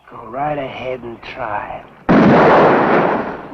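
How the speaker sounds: very slow, loud taunting challenge; menacing dare. Acted. Husband taunting Cal at gunpoint, slow menacing dare. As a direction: drag out the dare, daring him to move.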